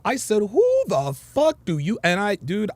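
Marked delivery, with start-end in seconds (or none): 0.4-2.0 re-enacted yell, slow and raised in pitch; retold yell. Man answering his partner's yell, retold slow half-yell. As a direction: drag out an outraged challenge, half yelled. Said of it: "I yelled it loud and proud".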